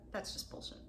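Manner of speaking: hushed, breathy aside; a confiding whisper. Real, not acted. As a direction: drop to a whisper for the swear. Aside about false compliments.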